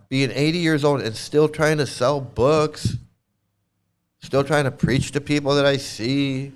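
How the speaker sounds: ordinary talk, nothing marked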